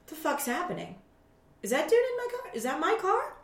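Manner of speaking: high, breathless double-take, half laughing; like you can't believe your eyes; startled disbelief. Retold or imitated. Startled seeing man in her car.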